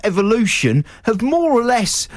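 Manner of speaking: rising mock rant, ending high; mock investor tirade, climbing in pitch; indignant dragon. Co-host as investor rejecting loose tea leaves, in an indignant rising rant.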